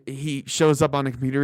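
normal talking voice

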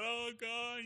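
high, soft mock wail to God; overwhelmed cry. Driving home overwhelmed by God's love, imitating a high, soft wail.

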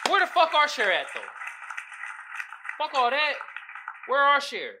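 loud, fed-up demand for a fair share; indignant rant. Real, not acted. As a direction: call them out hard. Ranting at politicians about compensation.

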